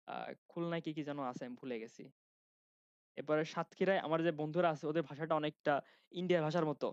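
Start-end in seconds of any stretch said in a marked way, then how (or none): none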